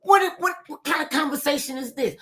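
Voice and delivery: high-pitched disbelief, what what what; shocked, thrown by the accusation; incredulous. Retold or imitated. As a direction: daughter stunned by sister's phone accusation, high and incredulous. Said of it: "I'm like".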